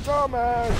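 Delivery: high jeer without the shout; restrained high-pitched taunt; high mocking repeat. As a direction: jeer way up high at moderate volume. Repeats the jeer more softly.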